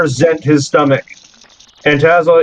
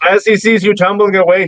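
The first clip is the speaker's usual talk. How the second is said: ordinary delivery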